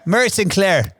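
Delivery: playful Northern Irish impression of a grandma's friend; Northern Irish accent. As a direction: slip into a Northern Irish lilt. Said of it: "how good you are at Northern Irish".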